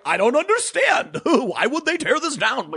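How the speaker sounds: high, baffled mock voice with a laugh; mock Bill Maher. Commentator imitating Bill Maher's baffled question, high and laughing. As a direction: play Maher as puzzled and amused. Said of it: "Bill Maher's like".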